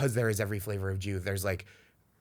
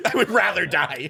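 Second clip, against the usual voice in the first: laughing; 5 semitones higher, 6 dB louder than usual. Real, not acted. Laughing echo.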